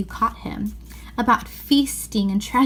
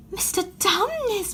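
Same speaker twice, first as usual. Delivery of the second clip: bawled; 10 semitones higher than usual. Acted. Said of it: "bawled Lucy in his ear".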